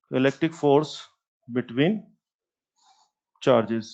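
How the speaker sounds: slow, measured dictation with pauses. Real, not acted. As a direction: dictate it slowly, phrase by phrase. Dictating a heading to write down. (धीमे, रुक-रुक कर लिखवाते हुए)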